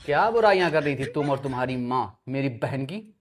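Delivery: low, soft, deliberate questioning; low-voiced threat to a silent suspect; menacing interrogation. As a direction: lean in quietly like an interrogator. Husband interrogates silent wife.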